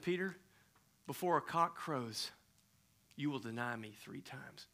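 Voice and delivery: sad, knowing tone; quiet prophecy. Acted. Jesus to Peter at supper, quiet prophecy.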